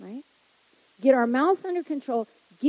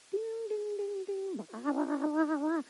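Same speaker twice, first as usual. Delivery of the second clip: high, soft sing-song ding-ding blah-blah; mocking chatter; 8 semitones higher, 8 dB quieter than usual. Preacher mimicking someone freaking out, high and soft. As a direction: tune out a ranting person like a bell. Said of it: "seeing somebody bouncing".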